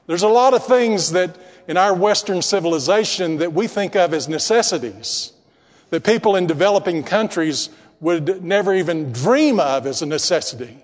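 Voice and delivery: normal talking voice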